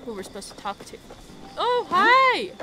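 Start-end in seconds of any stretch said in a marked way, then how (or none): 1.6-2.5 high, loud, surprised hello; delighted high-pitched holler; excited greeting. Real, not acted. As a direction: squeal hello on spotting someone. Greeting someone in game.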